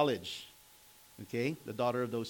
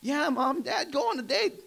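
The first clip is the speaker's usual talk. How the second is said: put-on high, loud excited boy voice; eager kid; 14 semitones higher, 7 dB louder than usual. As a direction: play the son urging his parents out. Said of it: "And the boy said".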